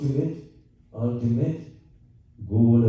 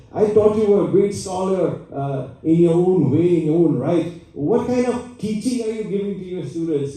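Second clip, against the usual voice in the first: higher and louder, an angry demand; angry shouting; 8 semitones higher, 4 dB louder than usual. Retold or imitated. Poor Brahman shouting at the scholar Parashar Bhatta, angrily. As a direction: rail at the scholar, voice raised high. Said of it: "he shouted at, at Bhattaji".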